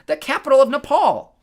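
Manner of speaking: high, loud boast; bragging. A narrator's reading. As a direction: crow it out proudly. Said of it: "Wasp bragged".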